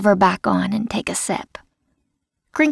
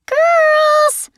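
high, loud shout across camp; yelled call; 5 dB louder than usual. A narrator's reading. Counselor yelling to girls running late, loud shout. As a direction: call the girls sharply from behind. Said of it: "we hear someone yell".

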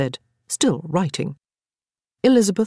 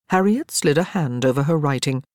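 plain reading voice

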